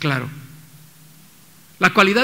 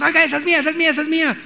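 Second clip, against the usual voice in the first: high, raised mock claim shouted from a distance; calling out from afar; 6 semitones higher, much faster than usual. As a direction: yell across the market that it's yours. Gritando desde lejos. (gritando desde lejos en el tianguis, tono agudo)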